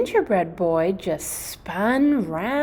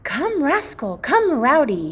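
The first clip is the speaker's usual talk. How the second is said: higher, louder call to the puppies; calling the dogs; 7 semitones higher, 3 dB louder than usual. Acted. The gingerbread boy calling the puppies by name, loudly. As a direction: call them over brightly.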